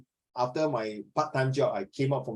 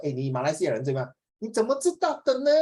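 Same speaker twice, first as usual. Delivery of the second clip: lilting Malaysian Mandarin question; Malaysian Chinese accent; faster than usual. Retold or imitated. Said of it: "The tonality".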